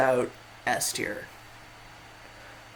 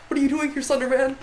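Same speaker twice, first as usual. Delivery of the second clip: put-on high, loud startled kid voice; imitating a shocked kid, pitched way up; surprised kid; 11 semitones higher, 4 dB louder, faster than usual. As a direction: squeak it out like a startled child. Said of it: "the kid's like".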